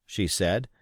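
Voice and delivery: ordinary delivery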